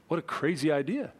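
usual speaking voice

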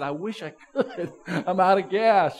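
chuckling through the words; a sheepish laugh while explaining. Retold or imitated. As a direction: laugh it off, embarrassed. Retold chat, laughing at himself.

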